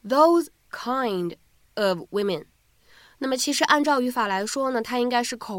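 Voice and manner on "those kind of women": slowly and carefully spelled out; slow demo read. Shown as a demonstration. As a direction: say it slowly so learners catch it. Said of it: "我们的原声当中呢，用的是". (慢速示范朗读)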